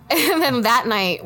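ordinary talk

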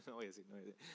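low, soft laugh through the words; real, quiet amused aside; amused chuckling. Real, not acted. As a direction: chuckle to yourself, holding back the joke. Laughs at own stray thought.

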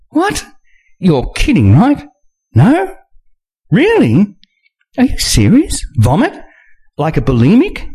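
can't believe what you're hearing; Nina incredulous. Acted.

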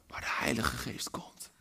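hushed whisper after the climax; sudden whisper. Real, not acted. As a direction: let it land in a whisper. (plots fluisterend)

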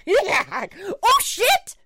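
squealed way up high and loud; hammed-up falsetto shriek; very high-pitched yelp. Imitating teenage son dive-bombed by a bat, high-pitched shriek. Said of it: "at such a high pitch".

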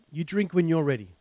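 ordinary talk

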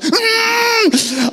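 high-pitched strained "mm"; clenched squeal. Retold or imitated. Whole room embarrassed by the son's reply, clenched squeal. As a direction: squeeze out a high, tense hum. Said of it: "synchronized buttock clench".

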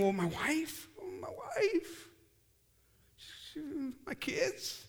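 mock inner worry, voice rising into questions; worried thinking. Man thinking about his wife and kids, worried. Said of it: "I'd be, like, thinking".

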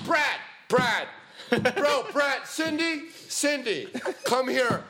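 high, eager calling out; imitating Sean calling friends. Imitating Sean calling friends over at his party, high and eager. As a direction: wave people over in an excited high voice. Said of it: "trying to just be like".

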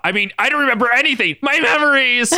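high-pitched mock anguish; hammed-up amnesiac despair; melodramatic lament. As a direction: wail it theatrically. Melodramatic amnesia line in story.

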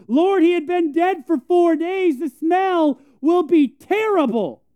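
high, loud, slow put-on woman's protest; Martha protesting. Martha protesting to Jesus at the tomb, high and loud. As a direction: whine it out like a fussy sister.

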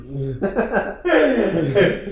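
squeaky high laugh; high giggle. Real, not acted. Laughs after mock word.

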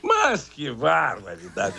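slow, low catchphrase drawl; drawn-out catchphrase. Acted. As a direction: stretch out the exclamation. Signature catchphrase exclamation.

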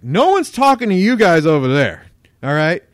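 loud furious snap at bystanders; a stranger at checkout shouting furiously at the table; angry yelling. Retold or imitated. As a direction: yell it hard at the nosy onlookers. Stranger yells at narrator's table.

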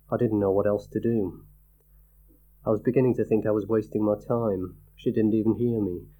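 usual speaking voice

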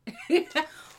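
a short high amused laugh, rising at the end. Real, not acted. Host laughs at her own question, a short high amused laugh.

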